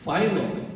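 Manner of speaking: phylum said FYE-lum; long-i correction. Shown as a demonstration. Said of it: "no, phylum".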